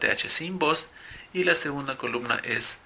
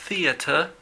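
"theater" ending in a British "-ta", no final r; 3 semitones higher than usual. Shown as a demonstration. Teacher switches to the British "theater", clearly marked. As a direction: say "theater" as a Briton would, theata. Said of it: "o si fuera británico".

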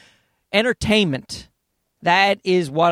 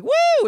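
crowd woo. Retold or imitated. Host imitates the hippie crowd cheering [name], whooping. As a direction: whoop like a stoned fan at a jam. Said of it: "everybody would be like".

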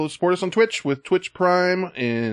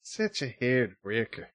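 Irish accent; 8 dB quieter than usual. Shown as a demonstration. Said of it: "Just try".

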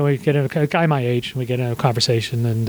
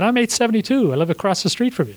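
higher-pitched, imitating a delighted discovery; mock astonishment, pitched up then easing down; retold amazement; 8 semitones higher than usual. New friend discovers he lives across the street, retold amazement.

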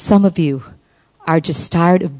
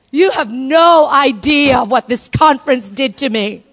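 ordinary talk, nothing marked

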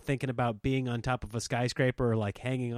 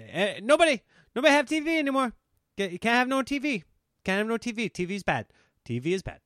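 higher put-on Eddie Murphy dad voice; Eddie Murphy impression; 8 semitones higher, faster than usual. Impersonating Eddie Murphy's dad banning the TV, higher and put-on. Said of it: "That's my Eddie Murphy impression".

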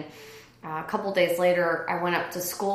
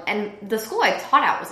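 usual speaking voice

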